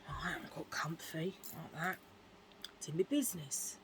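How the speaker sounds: hushed whispered aside. Real, not acted. Telling friends about the toilet, in a whisper. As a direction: drop to a breathy whisper as if sharing a secret.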